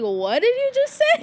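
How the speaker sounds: high-pitched, loud, shocked; shocked sister. Retold or imitated. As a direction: squeal it like a scandalised sister. Sister reacts to the swear.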